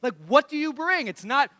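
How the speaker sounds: normal talking voice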